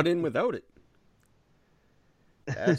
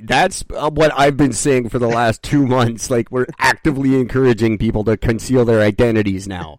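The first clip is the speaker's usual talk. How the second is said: loud rant; 10 dB louder than usual. Real, not acted. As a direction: hammer the point home.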